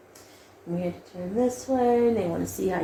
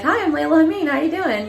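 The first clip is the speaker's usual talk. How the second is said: higher, eager audition hello; cheesy greeting; 8 semitones higher, 3 dB louder than usual. Retold or imitated. Greeting the casting room at an audition, cheesy and perky. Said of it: "I was just so cheesy".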